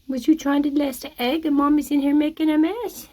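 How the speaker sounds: very high, gentle coaxing voice; high pet coaxing. Real, not acted. Inviting nervous hens into the coop, very high coaxing voice. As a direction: coax the hens in like babies.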